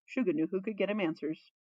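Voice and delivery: usual speaking voice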